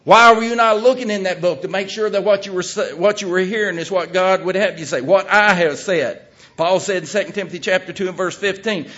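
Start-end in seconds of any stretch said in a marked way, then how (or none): 0.0-6.2 God rebuking. Retold or imitated. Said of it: "And he's gonna say".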